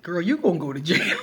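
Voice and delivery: roommate's warning, laughing; laughing quote. Retold or imitated. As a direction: warn her through a laugh. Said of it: "And she was like".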